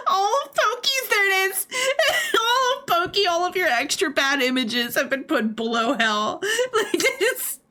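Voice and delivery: high, teary words squeezed out between laughs; laughing so hard the voice cracks; helpless laughter. Real, not acted. Streamer teasing Pokey about his images, breaking up laughing.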